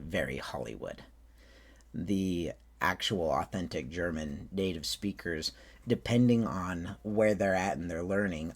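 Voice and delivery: usual speaking voice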